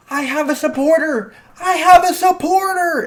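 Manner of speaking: very high, overjoyed exclamation building to a shout; ecstatic. Acted. Son learning his mom believes in him, ecstatic. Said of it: "He's excited".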